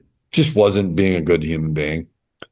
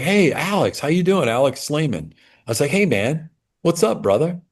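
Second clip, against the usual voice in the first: put-on higher, bright hello to a familiar kid; cheery greeting; 7 semitones higher than usual. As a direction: greet him like an old buddy.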